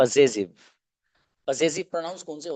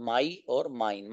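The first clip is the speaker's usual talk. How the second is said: my and mine drawn out, softer; slow clear model; 3 semitones lower, 6 dB quieter, much slower than usual. Shown as a demonstration. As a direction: repeat the two words slowly and softly. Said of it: "teacher models words slowly". (my और mine दोबारा धीरे और धीमी आवाज़ में)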